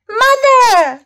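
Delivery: put-on high, drawn-out frightened child's call; crying out. A narrator's reading. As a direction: call for mother in a scared high voice. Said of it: "cried Amanda".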